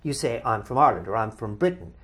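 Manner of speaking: ordinary delivery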